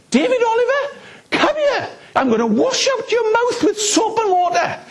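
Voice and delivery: high, laughing scolding granny voice; stern gran. Retold or imitated. Gran catching her grandson swearing, high laughing scold. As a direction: scold like a fierce Welsh gran, half laughing. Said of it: "and she said".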